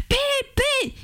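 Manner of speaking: very high single mock shout; retold shout. Woman shouting across the hall at the little man, very high mock shout. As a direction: fling the word out in a very high pitch. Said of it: "pendant que je criais".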